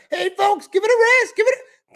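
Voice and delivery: very high, loud mock pleading; hammed-up crowd-hushing impression; mocking imitation. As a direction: squeal it in ridicule of him. Mocks the clip speaker's appeal.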